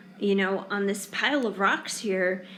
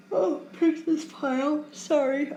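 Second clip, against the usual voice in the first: words stretched by a yawn; 6 semitones higher, 3 dB louder than usual. Real, not acted. Tarot reader tired on the last reading, yawning. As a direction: talk through a real yawn, higher pitched. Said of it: "I'm yawning".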